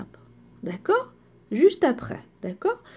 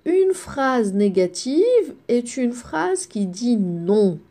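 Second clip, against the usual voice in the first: slow dictation; slower than usual. Shown as a demonstration. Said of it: "teacher dictating the rule".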